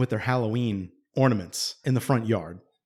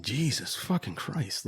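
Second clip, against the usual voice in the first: muttered exasperation; 6 dB quieter, slower than usual. Retold or imitated. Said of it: "exasperated at the night".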